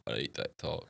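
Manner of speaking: drowsy, low and soft, barely awake; half asleep. Retold or imitated. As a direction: mumble it like it's half three in the morning. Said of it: "Half asleep".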